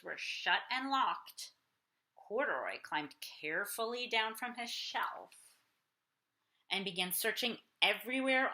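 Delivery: put-on lively read-aloud for kids; storybook reading. A narrator's reading. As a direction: read it like bedtime story time. Reading picture book aloud.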